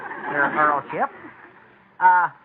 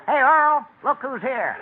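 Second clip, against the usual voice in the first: hollered announcement in a raised voice; calling out; 6 semitones higher, slower than usual. Acted. Butler calls out to master.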